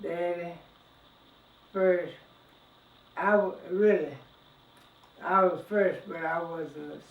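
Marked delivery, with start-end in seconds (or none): none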